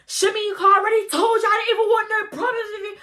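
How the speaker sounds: high, rushed, mock-tearful pleading; hammed-up wailing protest; acted-out protest. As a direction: plead at a high pitch, words tumbling out. Acts out protest as confrontation starts.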